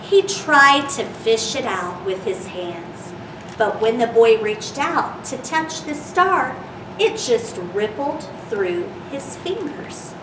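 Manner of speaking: gentle storybook reading voice; reading to children. Acted. Reads picture book to children.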